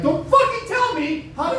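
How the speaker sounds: high, mock-angry snap; mock outrage. A comic boyfriend fakes outrage at his girlfriend, high and snapping. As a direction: fake offence at a kind goodbye. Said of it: "And I'm like".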